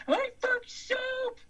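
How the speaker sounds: high, drawn-out put-on yell; mocking impression shout. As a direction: jeer it slowly in a high mock voice. Mocking Brian in his voice.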